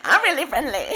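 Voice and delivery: high laughing Tyson voice; Mike Tyson impression. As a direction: squeak it like Tyson. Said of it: "that's how Mike Tyson sounds".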